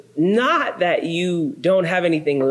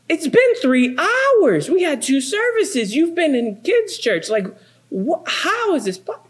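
exasperated disbelief; 11 semitones higher than usual. Retold or imitated. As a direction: pile on the disbelief at the kid. Father exasperated.

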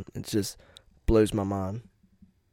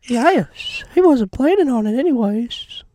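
much louder, much higher mock; 7 dB louder than usual. Teasing the friend in a loud high squeaky voice. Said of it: "mocking friend".

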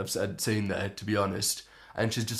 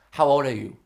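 lightly voiced masseuse, a little louder; masseuse's question; 3 semitones higher, 5 dB louder than usual. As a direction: put on the masseuse briefly, asking bluntly. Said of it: "and she goes".

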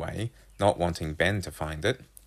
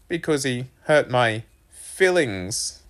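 put-on slow, raised boy's voice; punning son; 6 semitones higher, 4 dB louder, slower than usual. A narrator's reading. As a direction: drag out the pun, pitched up. Boy delivers pun to mum.

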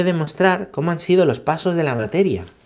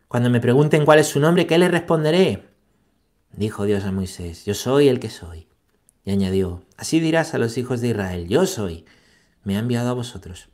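solemn reading from Exodus, rising louder at the end; scripture reading; 4 dB louder than usual. Acted. Priest reading Exodus three to his listeners, solemn. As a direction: read the passage like proclaiming scripture. Reads Exodus passage aloud.

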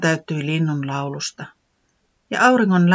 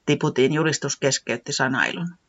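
ordinary delivery

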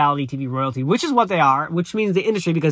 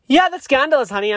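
sassy; 8 semitones higher, 5 dB louder than usual. Real, not acted. Sassy gossip reaction.

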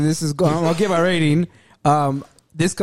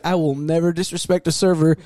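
ordinary delivery

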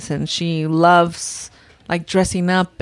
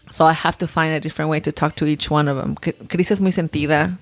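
ordinary talk

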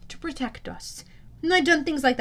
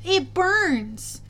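miserable, higher-pitched complaint; 7 semitones higher than usual. A narrator's reading. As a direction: put on a pained, pitiful voice. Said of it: "trying to sound miserable".

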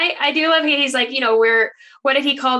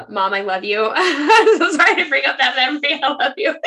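laughing, higher and louder; laughing apology; 4 semitones higher, 4 dB louder than usual. Real, not acted. Daughter apologising to her mom, laughing. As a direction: giggle out a sheepish sorry.

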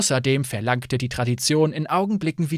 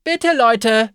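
higher, louder plea to stop; calling out; 8 semitones higher, 7 dB louder than usual. Acted. Innkeeper calling to her quarreling guests, loud. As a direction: call over the squabbling guests. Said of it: "rief Fayette".

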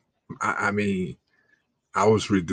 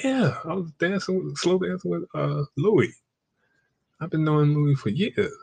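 put-on girlfriend voice; 7 semitones higher than usual. Girlfriend's casual excuse, voiced high.

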